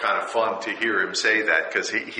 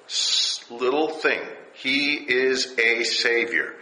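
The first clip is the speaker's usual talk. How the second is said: ordinary delivery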